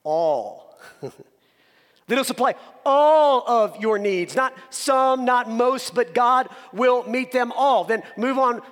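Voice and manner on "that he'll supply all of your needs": all pushed out louder and long; stress on all. Shown as a demonstration. Pastor stressing all while breaking the verse down. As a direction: hit the word all hard. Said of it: "put the emphasis on different words".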